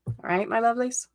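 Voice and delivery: ordinary talk, nothing marked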